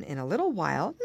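ordinary delivery

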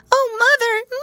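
very high, loud kitten wail; frightened kitten. A narrator's reading. Frightened kitten scrambles out of the jar crying to her mother. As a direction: cry out like a tiny scared kitten.

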